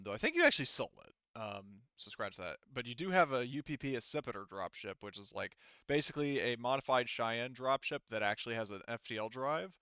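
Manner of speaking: normal talking voice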